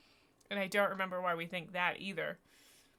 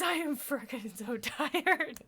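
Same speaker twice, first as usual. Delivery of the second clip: tired laughing; 7 semitones higher, 3 dB louder, slower than usual. Real, not acted. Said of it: "I'm hysterical".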